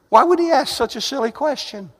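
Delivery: ordinary delivery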